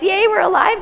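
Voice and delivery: high, loud happy cry; joyful cheer. Retold or imitated. Retelling the couple's morning cheer, high and loud. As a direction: cheer it out like waking up glad to be alive.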